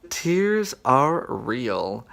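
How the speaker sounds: slow, drawn-out, a touch choked; half-joking, teary and slow; tearing up. Real, not acted. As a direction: let the tears be heard, slowly. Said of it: "Sort of, like, tearing up".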